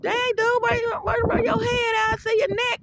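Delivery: high, squeaky mock call; playful high-pitched tease; teasing exclamation. Teasing a partner in bed.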